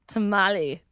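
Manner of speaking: ordinary talk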